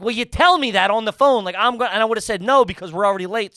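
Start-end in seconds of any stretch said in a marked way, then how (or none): 0.0-1.9 louder, higher exasperated comeback; retelling his irritated reply to wife, louder and higher; annoyed retort. Retold or imitated. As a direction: snap back at her, exasperated. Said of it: "I was like".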